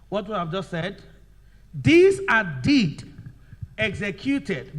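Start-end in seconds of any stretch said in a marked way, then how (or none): 1.7-4.8 loud, drawn-out words with firm breaks; slow dictation. Shown as a demonstration. Teacher repeating the definition for writing, slow dictation. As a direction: dictate it slowly and forcefully. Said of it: "Now let's write".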